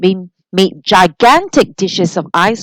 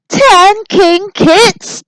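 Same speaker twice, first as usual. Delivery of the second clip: very high, loud, slow screech; 12 semitones higher, 6 dB louder, much slower than usual. A narrator's reading. Charlie learns ten king kids are coming, high loud screech. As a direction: stretch out a shocked screech. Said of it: "screeched Charlie McBean".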